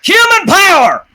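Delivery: high, loud rallying cry; villager's crowd shout. Acted. Angry villager at a torch-lit gathering, high shouted slogan. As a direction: yell it like an angry mob slogan. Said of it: "comes the occasional".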